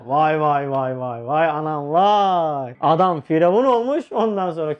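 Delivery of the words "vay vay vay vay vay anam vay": slow, drawn-out low wail; mock lament. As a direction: stretch every vay like mourning. Hayret nidası, yavaş. (yavaş, uzatılmış vay vay ağıtı)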